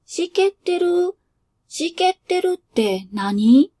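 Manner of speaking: put-on high child's voice, puzzled question; a kid hearing a strange word; 不思議そうな子供の声; curious child. Child asks what it means.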